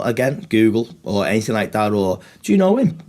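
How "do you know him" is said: much higher, eager question. Retold or imitated. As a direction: ask it high and curious. Imagined question to a bystander.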